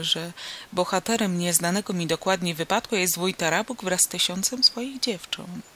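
ordinary delivery, nothing marked

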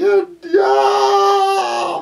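long high loud scream; panicked scream. Acted. Preacher acting the twelve in a filling boat, panicked scream. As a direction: cry out in acted storm terror. Frightened disciples in the storm.

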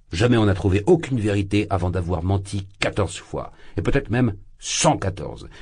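usual speaking voice

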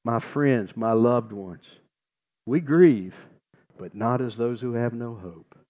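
ordinary delivery

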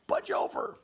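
very high squeaky crowded animal. Retold or imitated. Animals in the mitten telling the fox, high squeak. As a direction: squeak it high like a squashed critter. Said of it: "They're like".

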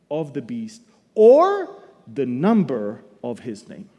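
loud, slow pulpit reading; forceful Bible reading from the pulpit; scripture reading. Acted. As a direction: proclaim the verse to the congregation.

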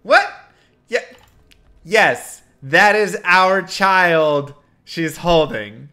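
high, loud, drawn-out insistence; incredulous correction. Retold or imitated. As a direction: retell it as flabbergasted, emphatic correcting. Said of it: "And I'm like".

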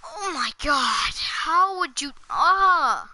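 loud exasperated ranting; frustrated rage. Real, not acted. Gamer raging after dying, loud frustrated rant. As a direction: vent loudly at the game.

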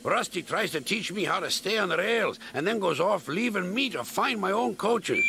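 high, complaining engine; aggrieved little engine airing a grievance; Duncan. A narrator's reading. Voices Duncan complaining to James.

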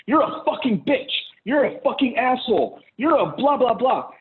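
high-pitched mock name-calling; mock insult. Man cursing at a partner as an example, mock-angry. Acted example of abusive reply.

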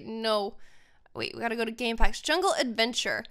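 ordinary delivery

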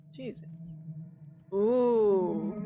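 ordinary talk

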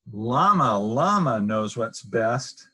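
usual speaking voice

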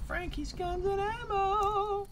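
very high, slow, loud sign-reading; mock announcer. Reading out the shop sign, high hammed-up announcer. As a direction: sing out the shop name, stretching the end.

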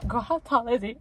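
ordinary talk

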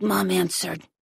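ordinary delivery, nothing marked

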